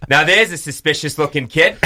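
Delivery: imitating the principal in an Australian accent. Asked by friends, doing the principal in an Australian accent. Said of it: "do it in your accent".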